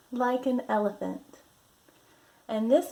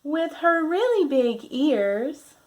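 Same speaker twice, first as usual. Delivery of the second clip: lively, drawn-out picture-book voice; reading to children; 6 dB louder, slower than usual. Acted. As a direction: read it like a storybook for little ones.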